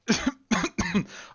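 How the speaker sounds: loud, high hacking cough fit; choking cough. Acted. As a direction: cough like you're choking on something. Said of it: "[name] suddenly starts choking".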